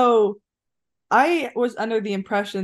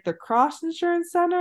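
quoting colleague; 12 semitones higher than usual. Retold or imitated. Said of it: "She's like".

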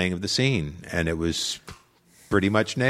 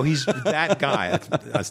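ordinary delivery

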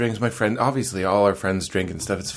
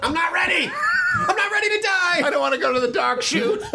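high, panicked shrieking bacon. Pretend. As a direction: squeal like doomed bacon. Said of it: "some bacon that was like".

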